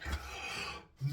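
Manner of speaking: sharp whispered gasp of shock; mock gasp. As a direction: gasp in playful disbelief. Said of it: "and I was like".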